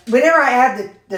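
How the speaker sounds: ordinary talk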